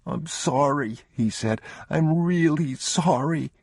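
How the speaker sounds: put-on a frightened boy about to cry; boy near tears. A narrator's reading. Boy apologizing to his father about the pistol. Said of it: "he was about to cry".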